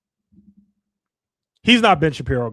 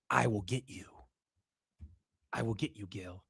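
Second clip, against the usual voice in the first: low, much softer threat; quiet menace; 9 semitones lower, 13 dB quieter than usual. Real, not acted. Threatening anyone who comes after him.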